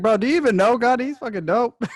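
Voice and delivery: high, loud, hyped-up retort; excited mock retort. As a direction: fire back in hyped-up mock outrage. Acts out own indignant youthful retort.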